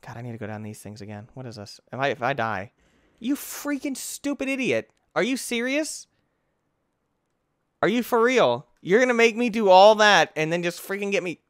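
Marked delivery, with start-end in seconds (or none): 3.2-11.4 high-pitched, indignant ranting; exasperated outrage. Real, not acted. Player scolding the game after dying, outraged. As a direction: protest in a raised, disbelieving pitch.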